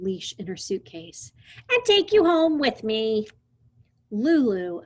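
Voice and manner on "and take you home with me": put-on bossy girl. Lulu voiced in read story.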